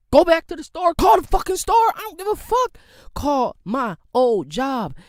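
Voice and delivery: loud, high demanding yell; angry shout. Real, not acted. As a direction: shout the order at them. Ranting at employers to call references.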